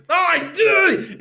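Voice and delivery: very high-pitched mock exasperated cry; hair-pulling exasperation. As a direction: act out pulling your hair out, voice shooting way up. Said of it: "we pull our hair out".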